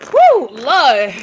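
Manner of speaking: loud startled woo; surprised exclamation. Real, not acted. Reader reacting to a heavy card, surprised.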